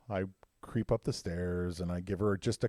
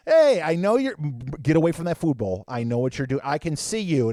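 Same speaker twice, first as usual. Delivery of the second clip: louder and higher, telling off the dog; scolding; 4 semitones higher, 9 dB louder than usual. Retold or imitated. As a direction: shoo the dog off the bowl. Scolding dog at food bowl.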